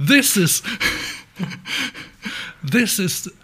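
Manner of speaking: high, halting, spluttering mock outrage; hammed-up slow, emphatic rant; imitated chef's outburst. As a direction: play an Italian chef lost for words with indignation. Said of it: "dann bricht's aus ihm heraus".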